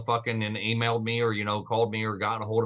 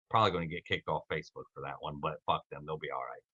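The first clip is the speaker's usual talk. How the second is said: low muttered aside; quiet aside; 8 semitones lower, 10 dB quieter, faster than usual. Real, not acted. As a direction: mutter it under your breath.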